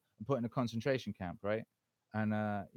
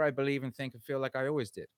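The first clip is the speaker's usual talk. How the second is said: ordinary delivery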